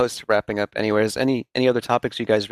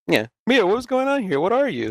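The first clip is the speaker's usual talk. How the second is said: high, offhand mock of Ethan greeting his wife; mock-casual husband, much higher; flat unconcerned husband; 10 semitones higher than usual. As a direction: toss it off with no worry, pitched up. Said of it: "It was just like".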